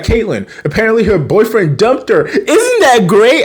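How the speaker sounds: high-pitched mock glee, climbing to the end; imitated excitement. Bree shares the concert news with friends, imitated giddy excitement. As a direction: gush like a thrilled girl, last words stretched and squealed. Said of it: "She's excited".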